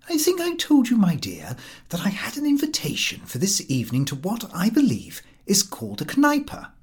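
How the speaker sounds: a timid husband confiding in his wife; Mister X voice. A narrator's reading.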